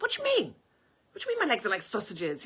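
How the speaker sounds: indignant high squeal, then fast and loud; shocked outrage at the comment; taking offence. Retold or imitated. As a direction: play the offended young woman. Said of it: "taking offence".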